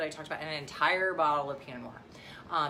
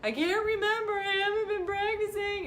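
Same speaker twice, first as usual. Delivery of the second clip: put-on very high, loud, panicky little-boy wail; hammed-up high child's whimpering cry; imitating crying son; 6 dB louder than usual. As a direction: mimic a kid melting down in the back seat. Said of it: "he's crying back there going".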